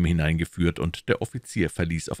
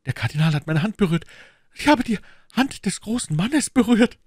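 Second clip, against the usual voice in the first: higher, louder, fast thrilled outburst; overjoyed cry; 5 dB louder than usual. Acted. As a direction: burst out in delight. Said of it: "rief Bonacieux". (überglücklicher Ausruf)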